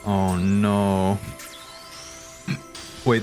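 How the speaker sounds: ordinary talk, nothing marked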